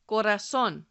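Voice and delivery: Corazón with the final syllable stressed, co-ra-ZÓN; stress on last O. Shown as a demonstration. Teacher asking learners to listen closely, Corazón stressed on the last O. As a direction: say Corazón as a clear model, landing on ZÓN. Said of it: "that stress is on the last O".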